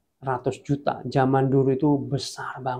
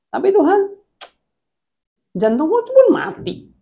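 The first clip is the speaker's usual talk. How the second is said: pleading protest; 5 dB louder than usual. Retold or imitated. Man pleading with God to stay.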